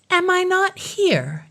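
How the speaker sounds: high, slow, sharp interruption; interrupting princess. Acted. Turritella interrupting the king, high and slow. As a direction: cut in from up high, drawn out. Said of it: "interrupted Turritella".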